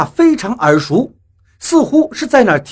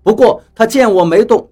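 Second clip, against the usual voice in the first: ordinary delivery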